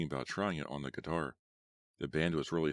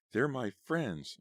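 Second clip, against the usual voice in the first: slow, higher, emotional; 8 semitones higher, much slower than usual. Acted. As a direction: plead it slowly with feeling. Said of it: "Melanie replied with emotion".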